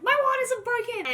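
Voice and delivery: high, loud, thrilled call to the midwife; excited shout. Retold or imitated. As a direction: burst out the news. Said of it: "and I was like".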